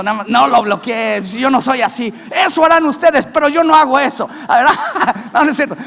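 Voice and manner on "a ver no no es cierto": laughing. Real, not acted. Laughs at own joke.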